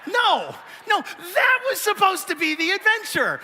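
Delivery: high, loud mock protest; high mock shout. Husband protesting to the crowd, high and loud. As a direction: yelp it in mock outrage.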